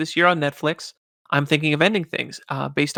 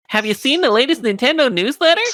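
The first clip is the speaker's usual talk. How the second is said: high, nasal-free nerd impression, louder; excited nerd pitching a newsletter to his friend; nerdy kid voice; 9 semitones higher, 5 dB louder than usual. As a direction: play the eager nerd from the ad. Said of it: "and he goes".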